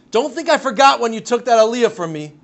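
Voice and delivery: loud, high, bitter jab; spiteful grudge. Acted. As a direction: hurl the old grudge at him from the stretcher. Shimon's spiteful jab before surgery.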